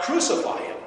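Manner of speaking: narrated outcry, pitched well up; crowd cry. A narrator's reading. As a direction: call out the crowd's demand. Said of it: "And they cried out".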